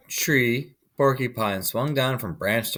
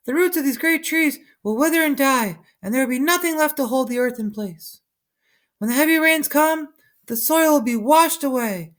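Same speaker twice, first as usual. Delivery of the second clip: chattering monkeys. A narrator's reading. Monkeys warning the sleeping man, high and chattering. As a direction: squeak it out like a monkey troop. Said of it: "They chattered".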